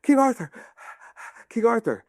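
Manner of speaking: high, urgent mock calling; distant caller. Sir Galahad chasing the departing army, high breathless calling. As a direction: call after the king between puffs of breath. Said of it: "you hear a voice".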